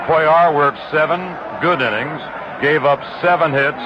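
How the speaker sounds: normal talking voice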